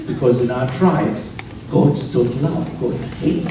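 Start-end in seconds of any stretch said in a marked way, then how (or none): none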